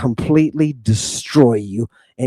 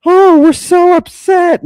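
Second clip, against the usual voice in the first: much higher, louder sarcastic mock whine; 8 dB louder than usual. Streamer mocking critics in a high, loud sarcastic whine. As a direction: parody their fake distress in a high squeal. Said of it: "Don't let their silliness".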